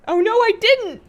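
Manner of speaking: sudden high, loud oh no; dismayed surprise. Real, not acted. Gamer realizing the loot despawned, loud dismay.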